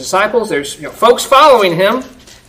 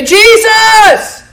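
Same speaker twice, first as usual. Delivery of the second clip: high, slow, loud pleading cry; a beggar's long, drawn-out cry for mercy; 13 semitones higher, 4 dB louder, much slower than usual. Retold or imitated. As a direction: call out desperately from the roadside. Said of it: "He began to cry out and say".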